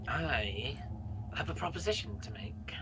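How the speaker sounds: whispered secret offer; conspiratorial whisper. Acted. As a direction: lean in and whisper the deal.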